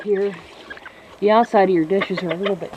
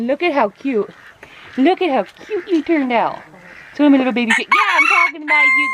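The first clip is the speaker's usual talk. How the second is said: cooing; 6 semitones higher than usual. Real, not acted. Cooing over cute chicken.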